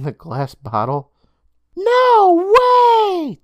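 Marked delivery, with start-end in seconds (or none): 1.8-3.4 put-on high, loud boyish voice, disbelieving. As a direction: refuse to believe it, kid voice. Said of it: "Day Day stated in disbelief".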